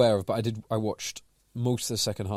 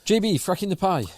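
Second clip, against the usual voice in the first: much higher and louder, announcing the topic; excited announcement; 11 semitones higher, 6 dB louder than usual. Real, not acted. Co-host cueing the fracking the pie segment, bright announcement. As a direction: call out the segment title with a lift.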